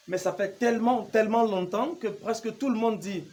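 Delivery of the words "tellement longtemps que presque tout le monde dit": usual speaking voice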